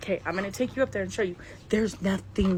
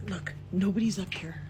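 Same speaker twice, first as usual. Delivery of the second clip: ordinary delivery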